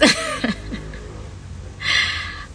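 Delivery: a short, high, airy laugh; quick unvoiced giggle; high breathy laugh. Real, not acted. Laughs at her own openness.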